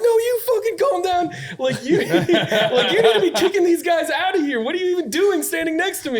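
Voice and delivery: very high, loud, laughing rage; screaming at the guard. Retold or imitated. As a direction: shriek it at the security guard, furious. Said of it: "I was like".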